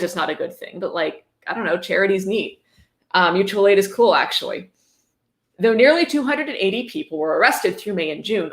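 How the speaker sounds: ordinary talk, nothing marked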